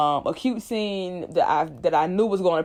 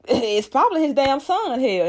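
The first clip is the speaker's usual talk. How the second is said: high, louder scoff; Carlton scoffing; 8 semitones higher, 3 dB louder than usual. Retold or imitated. Carlton mocking Will's guess, loud high scoff. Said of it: "Carlton like".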